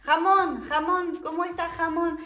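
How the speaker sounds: jamón with a harsh j sound instead of the R of Ramón; imitating her mistake. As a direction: greet him cheerfully as jamón. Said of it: "decía Ramón".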